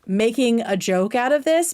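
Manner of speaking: ordinary talk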